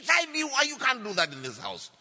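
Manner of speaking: high, loud shocked outburst; retold shout. Husband protesting to wife about sick children, shouting. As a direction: shout it in alarmed protest, easing slightly at the end. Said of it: "Ben, don't shout".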